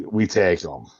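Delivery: normal talking voice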